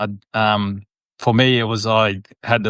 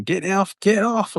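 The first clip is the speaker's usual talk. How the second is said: retold yelling; 10 semitones higher, slower than usual. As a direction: imitate the physios calling him off, pitched up. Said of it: "running over screaming and yelling".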